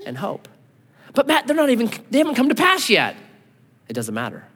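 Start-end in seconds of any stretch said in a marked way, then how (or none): 1.1-3.2 higher, quick whiny objection; mock objector. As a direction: pitch it up like a doubter butting in. Voiced objector from congregation.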